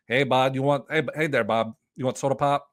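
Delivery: folksy Minnesota accent, lightly put on. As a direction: play a friendly Minnesotan offering a soda pop. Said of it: "my impression of every person that lives in Minnesota".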